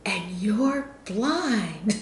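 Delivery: drawn out very slowly, imitating her disbelief. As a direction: stretch it out as if she can't believe it. Lady asks again, disbelieving.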